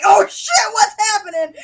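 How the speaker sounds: high, loud shouted panic; mock panicked shout. Fan reliving a book scene to co-hosts, shouting in panic. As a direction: cry out like it's all falling apart. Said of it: "And I'm like".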